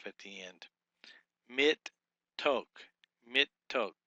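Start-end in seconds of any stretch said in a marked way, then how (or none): 1.5-3.9 Mit, then Tok, with a gap between; deliberate split of Mit Tok; syllable by syllable. Shown as a demonstration. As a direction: sound out Mit Tok in two separate beats. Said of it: "you'd make a division here".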